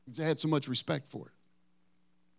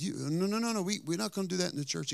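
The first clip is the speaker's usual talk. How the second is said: higher, softer mock holler; flustered young minister objecting, pitched up; mock protest in a hurried, raised pitch; retold hollered protest; 4 semitones higher, 5 dB quieter, faster than usual. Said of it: "hollered and said".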